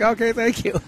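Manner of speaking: high, put-on caller voice; mock-sweet high thanks; imitated grateful caller. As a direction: pitch it up as a placated caller. Acts satisfied callers signing off.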